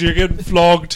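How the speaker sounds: a put-on Irish accent, leaning on flogged; rough Irish brogue, tossed off as a joke; mock Irish accent. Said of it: "We need to do more Irish accents".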